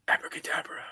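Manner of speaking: whispered magic word; mystic whisper. Pretend. Playing a magician's whispered last words to a podcast cohost. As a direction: breathe it out like a dying magician.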